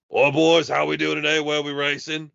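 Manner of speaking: hearty Australian mate greeting the lads; Aussie gamer. Retold or imitated. Aussie racer Captain Smash greeting the team in a loud Australian accent. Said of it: "he's like".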